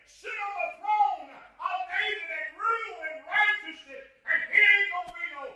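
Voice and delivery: shouted, high-pitched revival preaching; full-force preaching. Acted.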